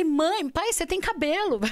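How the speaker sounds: high, loud retold amazement; astonished. Daughter seeing bald father with hair, astonished. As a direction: exclaim it in disbelief.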